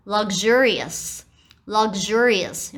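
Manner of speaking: slow, luxurious as "lug-zhurious"; careful pronunciation demo; voiced luxurious. Shown as a demonstration. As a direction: draw out luxurious, voicing the middle. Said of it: "念成有声化的方式".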